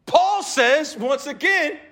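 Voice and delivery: loud, high, driving preacher voice; rising sermon fervour; full-force preaching. Acted. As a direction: preach it hard to the congregation. Preaching the dialogue.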